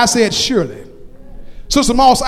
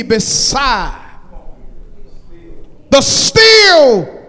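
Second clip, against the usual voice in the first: full-force preaching; 2 semitones higher, 3 dB louder, slower than usual. Acted. Preacher declaring the Lord is my shepherd, full-force preaching. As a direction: preach it slowly, hitting the big words hard.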